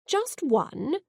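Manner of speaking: puzzled question, pitch lifted. A narrator's reading. Great-grandmother asks Kaelin about the runner, puzzled. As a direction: ask it genuinely puzzled. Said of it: "in a puzzled tone".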